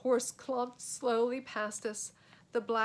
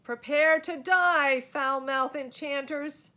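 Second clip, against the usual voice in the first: high, loud battle cry; knight's bellow; 5 semitones higher, 9 dB louder than usual. A narrator's reading. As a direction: bellow a challenge.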